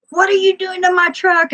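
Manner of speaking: high-pitched mimicked outrage; mock outraged yell. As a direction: imitate someone shrieking over their truck. Mimics truck owner's outraged challenge.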